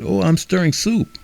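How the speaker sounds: high, sing-song answer; voice at the door. A narrator's reading. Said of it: "The voice answered".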